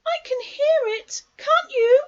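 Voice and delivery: high, loud nervous squeak; anxious Piglet. A narrator's reading. Piglet nearing the trap, anxious high squeal. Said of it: "said Piglet anxiously".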